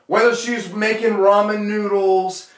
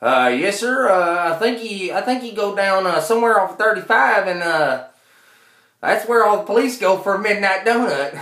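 Texas clerk; 3 semitones higher than usual. Retold or imitated. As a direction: answer like a wary small-town clerk. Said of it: "The clerk".

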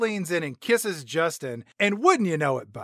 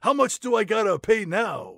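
busted guy voice; 6 semitones higher than usual. Retold or imitated. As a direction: sound caught and resigned.

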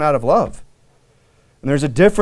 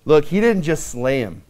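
ordinary talk

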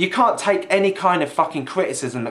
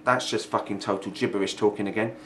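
ordinary talk

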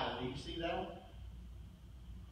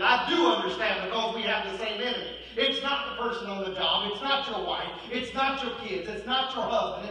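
ordinary talk, nothing marked

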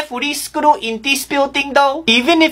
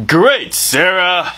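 weary dad voice; 7 semitones lower, slower than usual. Acted. Dad, low and slow.

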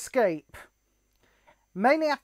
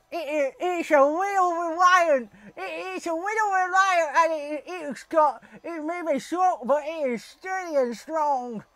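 put-on American accent; 3 semitones higher, 3 dB quieter than usual. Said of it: "did that American accent".

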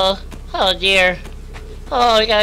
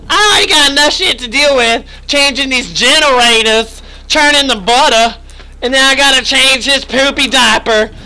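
higher, louder, sassy scolding at length; sassy rant; 4 semitones higher, 4 dB louder than usual. Acted. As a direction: tell Sophie off, fed up with chores.

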